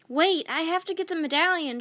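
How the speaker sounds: put-on boy shouting through the rain; shouted. A narrator's reading. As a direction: shout it over the storm. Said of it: "shouted Jack".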